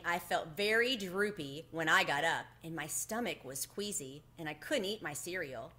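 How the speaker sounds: plain reading voice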